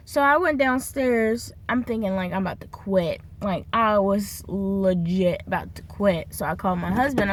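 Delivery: ordinary delivery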